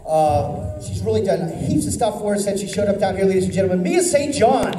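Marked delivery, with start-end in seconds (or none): 3.2-4.7 ring announcer call. Real, not acted. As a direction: boom her name to the hall like a ring announcer. Announcer introduces fighter to crowd.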